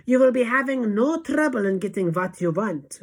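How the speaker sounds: high, loud assurance; Grand High Witch shout. A narrator's reading. Witch leader assuring her followers, loud shout. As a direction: shout it with total authority. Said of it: "shouted the Grand High Witch".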